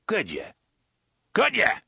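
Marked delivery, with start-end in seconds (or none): 1.3-1.8 loud, high, angry repeat; sudden high shout; shouted demand. A narrator's reading. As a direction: bark the question at the underling. Boss repeats question as a shout.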